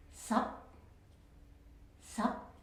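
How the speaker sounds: sup mid and clipped; mid checked tone. Shown as a demonstration. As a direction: stop sup short in the middle. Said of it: "the sound sup".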